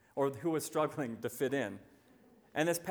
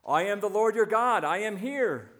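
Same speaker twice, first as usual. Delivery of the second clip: loud, higher booming announcement from above; voice of God; 6 semitones higher, 6 dB louder than usual. Acted. God answers the man from heaven, loud and proclaiming.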